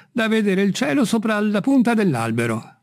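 high-pitched voice from up a tree; a hint of a servant calling down; lightly voiced Jupiter. A narrator's reading. Servant answering his master from the treetop, high-pitched.

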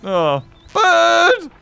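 high, stretched-out calls; excited cry. Pretend. Player chasing a bird, high excited calls. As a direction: call after it, drawing the word out.

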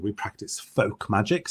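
ordinary talk

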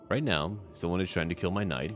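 normal talking voice

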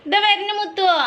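mother calling out that she is coming, high and loud. Real, not acted. Mother calls to child.

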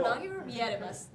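Japanese words slurred into fake English; mock English. Shown as a demonstration. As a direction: say it like an English phrase. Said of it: "ちょっとおしゃれな感じになって".

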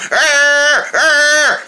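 drawn-out mock "arr"; playful monster growl. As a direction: growl like a pretend monster giving chase. Playful monster growl while chasing.

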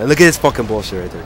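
loud, rapid burst of annoyance; indignant outburst. Real, not acted. Gamer protesting an unfair death mid-commentary, indignant. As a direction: break off and loudly protest, fast.

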